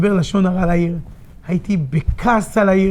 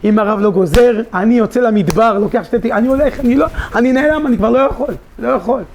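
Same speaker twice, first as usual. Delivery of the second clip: desperate plea; 6 semitones higher, 5 dB louder than usual. Retold or imitated. Desperate plea retold to rabbi.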